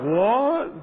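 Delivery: loud, high rising yelp; shocked disbelief. Retold or imitated. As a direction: act out hearing something appalling about yourself. Retold shock at friend's revelation.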